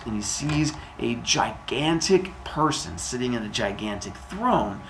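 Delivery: ordinary delivery, nothing marked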